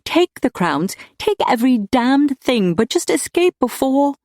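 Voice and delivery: high, urgent, desperate plea; tearful pleading. A narrator's reading. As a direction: implore him, pitch climbing. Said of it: "he could see those tears".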